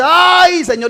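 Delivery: high, loud cry to God; overwhelmed cry. Retold or imitated. Predicador clama tras el milagro. (clamor desbordado, agudo y fuerte)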